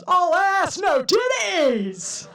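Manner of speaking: high, loud, drawn-out street holler; mock catcaller bellow across the street; catcall yell. Street catcaller yelling across road.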